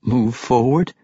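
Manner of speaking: tentative echo. A narrator's reading. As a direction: echo it back tentatively, rising at the end. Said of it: "he echoed tentatively".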